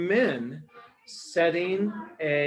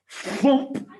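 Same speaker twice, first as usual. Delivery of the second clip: thump sound; 9 semitones higher, 5 dB louder than usual. A narrator's reading. Lion falls down.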